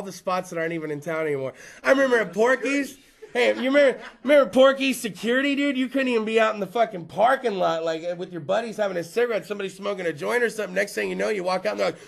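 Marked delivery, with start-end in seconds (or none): none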